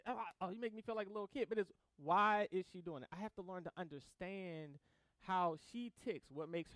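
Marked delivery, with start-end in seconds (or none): 0.1-1.5 quick mock whine. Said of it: "It's just like".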